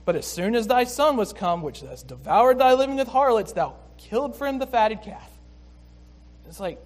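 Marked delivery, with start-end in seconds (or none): 0.0-5.3 sarcastic. Retold or imitated. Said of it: "the sarcasm".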